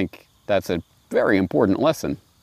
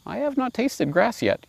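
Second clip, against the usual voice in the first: much higher, gentle innocent lamb voice; polite little lamb. Acted. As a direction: answer sweetly like a small lamb. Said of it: "The lamb was still very polite".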